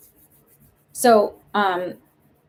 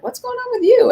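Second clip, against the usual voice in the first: put-on much higher, curious boyfriend voice. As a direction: voice the boyfriend asking, suddenly interested. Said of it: "he's gonna be like".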